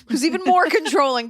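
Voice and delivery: high, laughing twist of the letter; laughing mock misread. Host teasing the letter writer while reading it, laughing.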